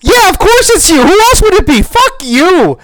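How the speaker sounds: high, loud sneering parody of a smug host; mocking the host. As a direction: sneer at him as you mimic his smugness. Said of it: "he's like".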